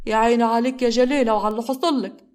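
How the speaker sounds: hammed-up self-pity lament; fake crying. Grandmother fakes tears to win over her son, hammed-up wailing. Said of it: "جليلة بتمثل العياط".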